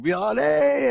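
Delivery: high, loud drawn-out goal-celebration yell; celebrating scream. Retold or imitated. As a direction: scream it like a kid who just scored. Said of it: "literally just screaming, uh, celebrating".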